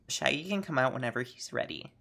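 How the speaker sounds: normal talking voice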